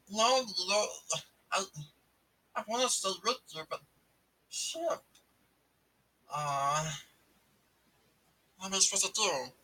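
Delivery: high, fast pleading alien voice; sad Stitch voice. Retold or imitated.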